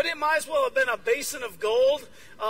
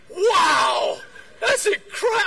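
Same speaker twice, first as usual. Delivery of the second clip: loud, high, awestruck outburst; shouted wonder, climbing higher; yelled amazement; 9 semitones higher, 9 dB louder, slower than usual. Retold or imitated. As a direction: re-enact blurting it out, thrilled. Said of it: "And I yelled out".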